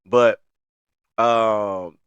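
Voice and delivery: ordinary talk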